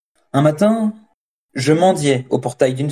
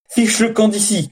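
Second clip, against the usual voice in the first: shouted order; 9 semitones higher than usual. A narrator's reading. Farmer raising his stick at the extortioner, contained shout. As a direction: cry out in outrage, volume held back. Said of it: "cria-t-il en levant son bâton".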